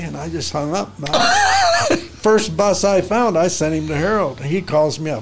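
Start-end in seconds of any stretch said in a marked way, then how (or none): 1.0-2.2 high wheezing laugh; high cackle of laughter; burst of laughter. Real, not acted. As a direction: laugh out loud at the prank. Laughs at own prank.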